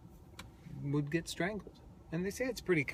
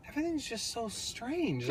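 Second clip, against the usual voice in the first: bewildered inner voice; 10 semitones higher than usual. Retold or imitated. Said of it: "And I'm like".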